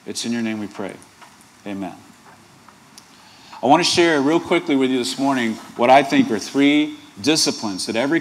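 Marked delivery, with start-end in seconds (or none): 0.0-2.0 soft, low opening prayer. Acted. Pastor opening the sermon in prayer, soft and low. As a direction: pray quietly over the reading.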